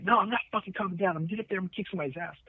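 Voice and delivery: high-pitched imitation of an irate colleague; mock freak-out. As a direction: imitate someone refusing to settle down, speeding up. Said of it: "he's just freaking out".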